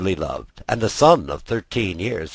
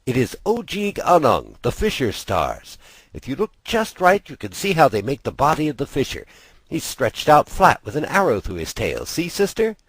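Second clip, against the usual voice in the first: a hint of an eager boy, pitched higher; young brother pointing out the stars, eager; lightly voiced boy; 6 semitones higher than usual. Older brother showing his sister the fisher stars, lightly voiced boy.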